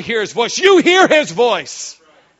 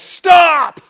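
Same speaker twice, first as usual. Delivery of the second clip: higher, loud commanding stop; sharp stop; 6 semitones higher, 8 dB louder than usual. Real, not acted. Preacher cutting off a doubter, commanding shout. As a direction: cut them off hard.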